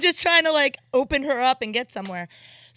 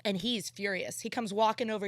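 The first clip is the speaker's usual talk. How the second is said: ordinary talk, nothing marked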